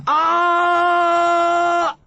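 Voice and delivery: long high loud roaring cry; battle cry. Acted. Big Mac charging forward, loud high war cry. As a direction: charge screaming at them.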